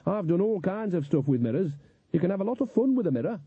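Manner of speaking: high, lively defensive voice; protesting. A narrator's reading. Nanny defending her fun with mirrors, protesting. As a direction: argue back cheerfully, pitched well up. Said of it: "protested Nanny".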